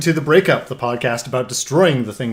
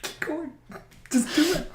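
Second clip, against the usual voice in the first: laughing urging; 8 dB quieter than usual. Real, not acted. Cohost urging through laughter.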